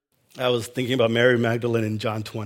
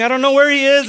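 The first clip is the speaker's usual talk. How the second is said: high, loud, panicked cry; distraught cry; 11 semitones higher, 8 dB louder, faster than usual. Retold or imitated. Mary searching the garden for Jesus, distraught and loud. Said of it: "running around saying".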